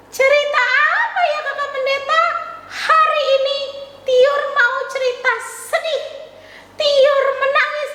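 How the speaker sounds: high, cheery puppet voice; chirpy child puppet saying hello; puppet girl Tiur. Acted. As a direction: greet the kids as the little puppet. Puppet Tiur announces sad story.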